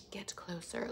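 very soft and low; playful, quiet murmur; hushed close-up. Pretend. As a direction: say it softly, as if leaning right in. Acts out request to get closer.

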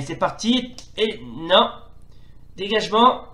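lively football play-by-play; french sports commentary. Acted. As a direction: call the match live, rising with each attack. (commentaire sportif à la télé)

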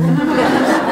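high delighted laugh; bright amused laugh; burst of laughter. Real, not acted. Laughs at the memory.

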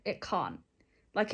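ordinary delivery

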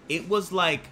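ordinary talk

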